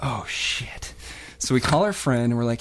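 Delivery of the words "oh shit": hushed whisper. Retold or imitated. Boys whispering at a sudden discovery. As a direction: breathe it out in secret. Boys realise prank chance.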